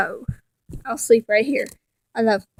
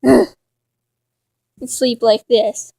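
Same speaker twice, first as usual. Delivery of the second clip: slow sleepy; much slower than usual. Acted. Settling onto the pillow.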